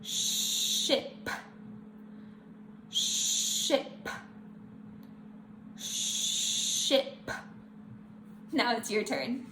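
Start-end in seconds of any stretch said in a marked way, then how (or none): 0.0-7.3 Ship with a long held sh. Shown as a demonstration. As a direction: stretch the sh in Ship. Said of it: "one more word with sh".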